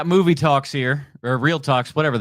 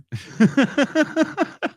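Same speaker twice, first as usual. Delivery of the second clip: a high, bursting laugh; real burst of laughter; 11 semitones higher than usual. Real, not acted. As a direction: laugh out at your own slip. Laughs at own mixed idiom.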